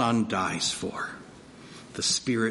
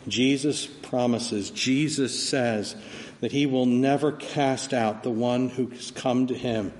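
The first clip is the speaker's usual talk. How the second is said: ordinary talk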